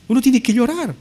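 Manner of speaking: high-pitched, put-on indignation; indignant protest. Mourner answering the impertinent visitor, indignantly.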